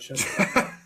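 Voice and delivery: whispered, dry brush-off; wife's dismissal. Retold or imitated. Wife answering husband's boast, whispered brush-off. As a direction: toss it off in a whisper like a tired wife. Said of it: "she goes".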